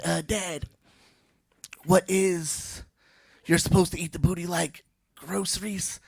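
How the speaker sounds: lightly voiced kid asking earnestly; a hint of a nerdy son. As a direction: play the son answering like a game show. Son's question voiced in joke.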